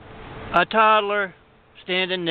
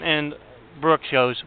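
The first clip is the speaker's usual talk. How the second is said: ordinary delivery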